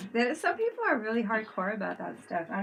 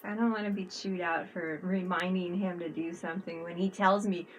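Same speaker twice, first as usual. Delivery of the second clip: ordinary talk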